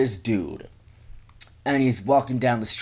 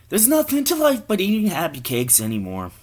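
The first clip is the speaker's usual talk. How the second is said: high-pitched mock-dramatic line, sliding down; acted commercial character; 10 semitones higher, 3 dB louder than usual. Man shoveling down Happy Cakes, acted high-pitched. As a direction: start way up high, then sink.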